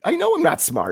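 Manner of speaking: high, loud goofy voice; mock dumb voice. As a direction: play the dummy, squeaky and loud. Repeats joke, much higher and louder.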